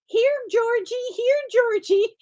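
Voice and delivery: very high sing-out, imitating a cat caller; put-on high "here, kitty" call. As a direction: mimic someone coaxing a cat in a squeaky-high call. Mimics Margot calling the cat.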